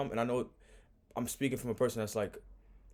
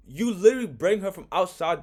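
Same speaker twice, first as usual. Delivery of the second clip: louder and higher, indignant; heated; 7 semitones higher, 8 dB louder than usual. Real, not acted. Host scolding her for bringing the woman inside, heated. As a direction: really lean into the outrage.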